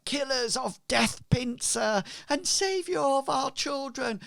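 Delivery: high, gushing crowd praise; cheering townsfolk. Acted. Grateful crowd rallying round the heroes, high cheering. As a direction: hail them in a high, grateful voice. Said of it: "they all start cheering again".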